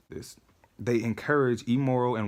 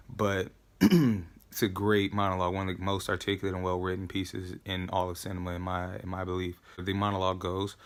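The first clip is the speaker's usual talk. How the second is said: ordinary talk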